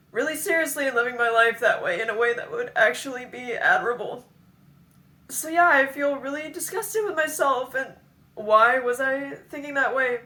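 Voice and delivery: high, loud, upset outpouring; distraught self-reproach. Real, not acted. Asking herself why she behaved that way, distraught.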